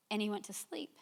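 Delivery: softly whispered aside. Real, not acted. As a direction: drop to a whisper for the punchline. Quiet punchline about husband sleeping.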